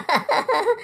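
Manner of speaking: mocking laugh. Laughing at Squid Game.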